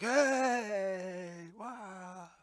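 high impressed crowd cheer. Acted. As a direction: cheer in surprise. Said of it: "everybody's like".

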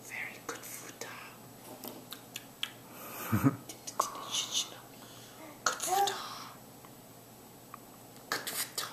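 whispered good food; whispered praise. Real, not acted. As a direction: breathe the praise softly. Feeding baby, hushed praise.

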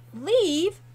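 sharp high indignant "Leave?". A narrator's reading. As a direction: fling it back in disbelief. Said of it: "Hawk replied indignantly".

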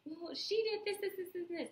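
high-pitched gossipy friend; high voice, listing complaints; mimicking friend. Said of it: "they're like".

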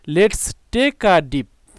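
each word drawn out very slowly; slow model sentence. Shown as a demonstration. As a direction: read the example aloud slowly for students. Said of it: "example sentence দেখি".

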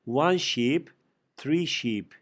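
"one sheep" said slowly with the stress on "one"; slow example. Shown as a demonstration. Teacher slowly modelling unchanged plural for students. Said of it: "Mesela bu şöyle bir şey".

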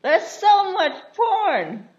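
high, loud, drawn-out disbelief; mock shock. Friend finds what is on the laptop, mock shocked. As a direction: exclaim it slowly, way up high.

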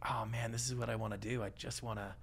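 whispered inner thought. Retold or imitated. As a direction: breathe it out like a private realization. Retells a private realization.

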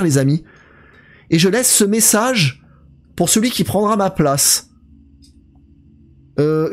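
put-on slow, spooky reading of the old guard's letter; dramatic letter reading. Streamer reading the dead guard's note aloud, slow and spooky. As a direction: read it slowly like a ghost story.

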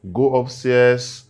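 slow cold order. Acted. Husband orders wife out.

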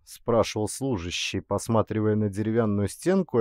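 ordinary delivery

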